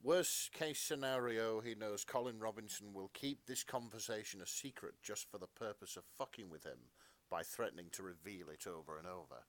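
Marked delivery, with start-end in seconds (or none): none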